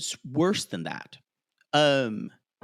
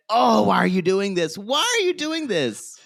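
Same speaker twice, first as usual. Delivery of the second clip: exasperated outburst; 4 semitones higher, 5 dB louder than usual. Retold or imitated. Host retelling the outburst at gaslighting on screen, exasperated rising wail. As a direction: plead in exasperation, stretching the second why.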